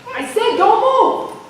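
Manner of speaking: loud, high police commands; officer bellowing freeze; cop shouting orders. Acted. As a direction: yell it at an armed man. Cop yelling at armed suspect.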